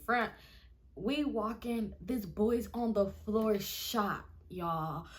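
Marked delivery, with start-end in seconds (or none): none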